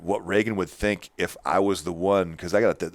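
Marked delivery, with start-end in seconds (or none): none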